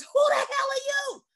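high, loud indignant demand; a furious doctor barking at a stranger; angry doctor. Retold or imitated. Doctor confronting the chaplain at the bed, angry demand.

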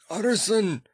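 high, pleading cry; begging voice, pitched far up; desperate plea. A narrator's reading. Voice pleads from behind the door.

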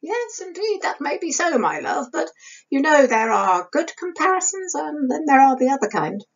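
wry, teasing, high voice; sly grin. Acted. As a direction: say it with a sly twinkle. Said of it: "said with a grin and a twinkle".

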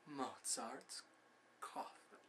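very soft, barely voiced; hushed relish. Schemer picturing his rival's coffin, hushed. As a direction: let it fade into a whisper.

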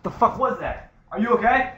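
sudden alarmed yell, pitched high; startled shout. Real, not acted. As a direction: call out in real fright. Sudden scare upstairs, calling out.

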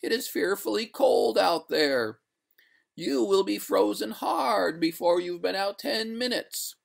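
high-pitched ghostly pleading; reluctant ghost. A narrator's reading. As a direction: object in a high, wailing ghost voice. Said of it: "returned the ghost with a pale reluctance".

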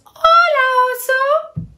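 very high, squeaky little animals answering; squeaky chorus of small animals; tiny animal voices. Acted. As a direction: chirp it high like small creatures.

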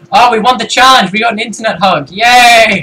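loud, high celebration; excited cheer. Real, not acted. Gamer celebrating a completed challenge, excited cheer. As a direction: cheer the win at full voice.

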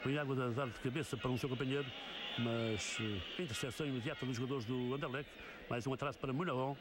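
steady live play-by-play; football commentary. Real, not acted. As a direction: call the match as it unfolds.

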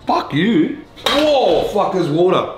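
high-pitched alarmed outburst; startled swearing. Real, not acted. Cook finds water in the pan, startled swearing.

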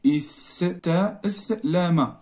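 استسلم very slowly, each syllable apart; slow dictation. Shown as a demonstration. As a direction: draw out استسلم as if writing it letter by letter. Dictating word while writing it.

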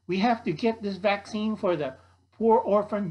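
lightly acted nun making an appeal; pleading nun. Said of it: "says the, the nun".

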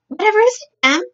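high, startled maid's question; a sudden high-pitched cry of concern. A narrator's reading. Maid to her distressed mistress, startled concern.

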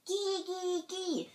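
high, drawn-out bird calls; chickadee call. A narrator's reading. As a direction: pipe it like a little bird. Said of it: "said the chickadee".

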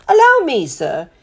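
high start dropping to a deferential sir; butler's lilting politeness; polite butler. A narrator's reading. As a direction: offer help courteously.

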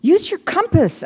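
high, slow cry. A narrator's reading. Ellis crying instructions to Maddie, loud. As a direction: call the instruction over the noise. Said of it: "Ellis cried".

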